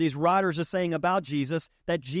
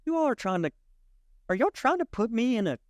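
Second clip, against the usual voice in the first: incredulous divine voice; 7 semitones higher than usual. Acted. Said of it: "the divine is like".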